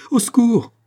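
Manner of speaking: high, loud cry for help; panicked yell. A narrator's reading. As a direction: yell out as a frantic caretaker. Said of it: "hurla le concierge affolé". (hurlement affolé)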